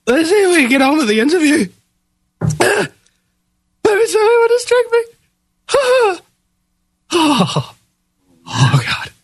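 strained voice, eyes watering from chili; mouth burning from spicy food. Real, not acted. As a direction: talk through a burning mouth. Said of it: "my eyes are watering pretty badly".

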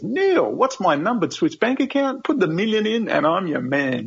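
high-pitched, drawn-out opening, wheeling and dealing; eager mock offer. Telling interviewer the reply he wishes he'd given, mock-eager. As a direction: pitch it high like a cheeky deal-maker.

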